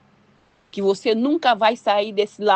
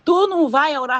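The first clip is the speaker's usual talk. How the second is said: louder, higher pressing question; insistent demand; 6 semitones higher, 5 dB louder than usual. Acted. God asking the woman who won't pray, insistent. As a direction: press hard for an answer.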